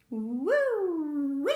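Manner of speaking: whooping with delight; loud joyful whoop; thrilled roundabout cry; loud woo-wee. Acted. Riding the roundabout.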